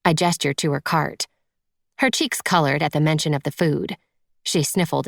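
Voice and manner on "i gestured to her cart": usual speaking voice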